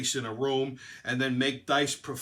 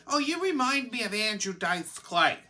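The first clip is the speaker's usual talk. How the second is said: high-pitched mock fan voice; mimicking people who come up to him; imitated admirer; 7 semitones higher than usual. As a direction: put on a higher voice for the quote. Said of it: "people come up to me and they say".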